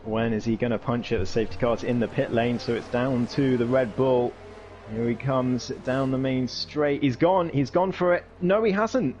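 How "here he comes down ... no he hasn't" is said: higher, louder excited race call; motorsport commentator. Acted. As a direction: call the restart with rising excitement. Safety car restart, overtakes.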